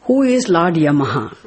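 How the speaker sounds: normal talking voice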